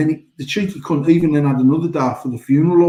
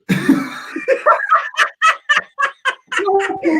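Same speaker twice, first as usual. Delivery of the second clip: big high burst of real laughter; helpless cackle. Real, not acted. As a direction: howl with laughter at the story. Laughing at wife's vegan mixup.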